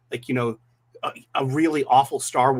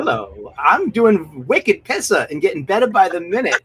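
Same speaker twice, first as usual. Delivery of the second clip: hammed-up thick Boston accent; 3 semitones higher, 4 dB louder than usual. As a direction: lay the Boston accent on heavy. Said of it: "I'm hamming up my Boston accent".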